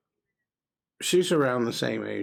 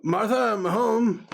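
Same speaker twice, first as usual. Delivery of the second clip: high, raised husband's call; 10 semitones higher, 4 dB louder, slower than usual. Acted. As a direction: sing out your arrival to the house. Husband calls into the house.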